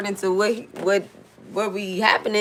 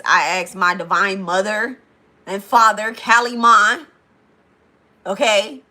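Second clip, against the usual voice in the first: a declaration of prayer that builds in force; fiery prayer; 5 dB louder than usual. Real, not acted. Streamer prays aloud for false altars to burn, building fervour. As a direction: call on the divine to burn every false altar.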